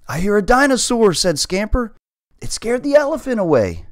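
high, loud frightened cat; a terrified little cat; panicked, high voice. Acted. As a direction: squeal it out in panic. Panicked cat hears dinosaur.

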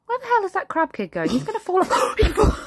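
rising alarm. Acted. Mother watching the crab kid on the monkey bars, alarmed. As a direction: watch the child wobble and fall.

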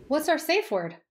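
high-pitched questioning heroine; heroine's voice. A narrator's reading. Heroine's line in acted dialogue.

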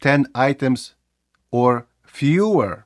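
"ten items or fewer" with "fewer" drawn out slowly; careful correct version. Shown as a demonstration. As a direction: stretch out "fewer" deliberately. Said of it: "за правилами мало бути". (повільно протягнуте "fewer")

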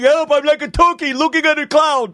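mock panic. Mocking friend's panic.

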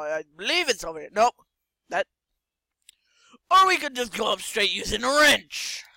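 ordinary talk, nothing marked

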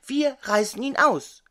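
calling out suddenly to his brother. A narrator's reading. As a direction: frog calls his brother to help pull, suddenly and eagerly. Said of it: "rief er plötzlich".

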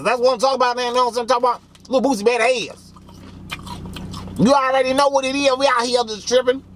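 loosely imitating the rapper's swagger; hammed-up rapper hype, clearly not his own voice. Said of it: "I probably do a bad impression of [name]".

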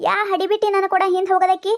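usual speaking voice

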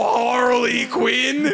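high, drawn-out Southern-sounding cartoon voice. Shown as a demonstration. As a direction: botch a tri-state accent into Southern, stretched out. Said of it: "why do you wanna be Southern today?".

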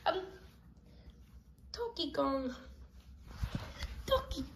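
normal talking voice

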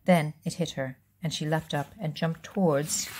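plain reading voice

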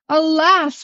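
storybook wail of alas; crying out. A narrator's reading. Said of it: "cried the ghost".

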